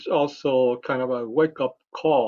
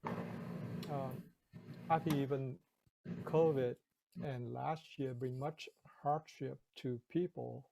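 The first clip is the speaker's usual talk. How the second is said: muffled, much softer, as if behind a hand; 14 dB quieter than usual. Real, not acted. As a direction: speak through a covered microphone. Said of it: "your sound is a little bit, uh, muffled".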